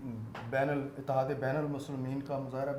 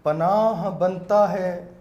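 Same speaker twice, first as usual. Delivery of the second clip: louder, slow, drawn-out recital; naat recitation; 3 semitones higher, 10 dB louder, slower than usual. Acted. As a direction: repeat the verse with more force. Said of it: "نعت کے چند شعر پیش کرنا چاہوں گا".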